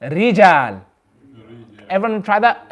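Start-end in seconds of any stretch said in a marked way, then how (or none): 0.0-0.8 "rijal" with a faint shiver on the r; slight trill. Shown as a demonstration. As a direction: trill "rijal" only lightly. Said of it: "listen very carefully".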